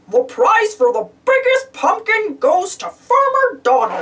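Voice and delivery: lightly voiced judge announcing; Judge Goofy voice. Goofy judging pumpkins, a hint of announcer. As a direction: announce the winner with a flourish. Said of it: "he said".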